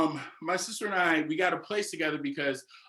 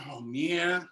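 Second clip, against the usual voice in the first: put-on teasing child voice; much slower than usual. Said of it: "he was like".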